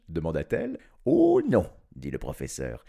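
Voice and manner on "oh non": very high, loud exclamation; emphatic refusal. A narrator's reading. Professor refusing to confess to his wife, emphatic.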